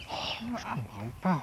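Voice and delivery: whispered, puzzled aside; whispering. Acted. Casimir whispering to himself, puzzled about his gloubi-boulga. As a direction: whisper it to yourself, baffled.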